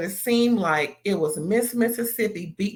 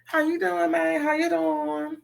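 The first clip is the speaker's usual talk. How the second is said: much higher, sing-song flirty voice; flirty greeting; 9 semitones higher, 3 dB quieter than usual. Retold or imitated. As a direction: flirt with him coyly. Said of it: "And Terica like me".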